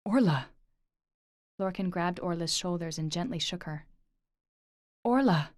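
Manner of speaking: loud urgent call of her name. Acted. Lorcan calling to entranced Orla, loud urgent call. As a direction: shout her name to snap her out.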